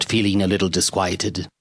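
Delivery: plain reading voice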